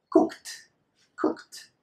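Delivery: "Cooked" as cookt, one syllable. Shown as a demonstration. Teacher models a new K verb for learners, ending in T. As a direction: end "Cooked" on a crisp KT. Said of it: "transform this E D into a T sound".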